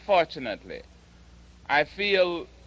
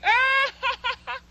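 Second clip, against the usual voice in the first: shrill high "Ah!" then forced laugh; high startled cry and laugh. Retold or imitated. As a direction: mimic the maid's nervous laugh. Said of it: "she goes".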